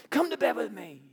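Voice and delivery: pleading seductress pushing harder; insistent repeat. Acted. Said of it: "grabs him by the coat and says".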